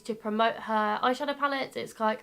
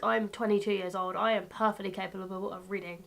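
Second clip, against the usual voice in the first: ordinary talk, nothing marked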